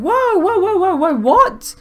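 high, loud string of startled whoas; startled outburst. Real, not acted. Gamer caught off guard mid-sentence, startled and loud. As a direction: blurt it out in real surprise.

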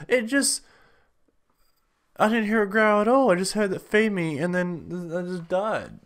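indignant. Real, not acted. Dies, disbelieving outburst.

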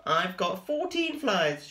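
slow, high and proud; lightly high Spinderella. A narrator's reading. Spinderella tells her siblings, slow and high. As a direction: count it out proudly.

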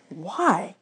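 ordinary delivery, nothing marked

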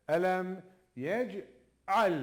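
very slow, drawn-out "Elem yec'al"; slow recited demo. Shown as a demonstration. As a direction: draw it out like a recitation. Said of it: "Elem başındaki gibi". (yavaş, uzatarak okuma)

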